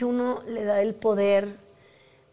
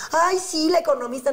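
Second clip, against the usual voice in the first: imitating a mocking troll, higher and a bit louder; mocking sneer; 6 semitones higher, 3 dB louder than usual. Imitating trolls who mocked her online, scornful sneer. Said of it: "si me escribían y se burlaban".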